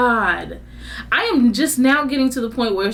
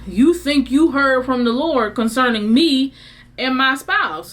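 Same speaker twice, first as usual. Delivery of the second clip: normal talking voice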